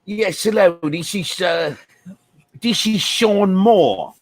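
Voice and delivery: a lightly put-on, terrible British accent, slow on the name; hammed-up bad British accent. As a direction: fake British caller, barely disguised. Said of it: "there hasn't been a worse accent".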